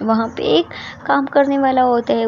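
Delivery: usual speaking voice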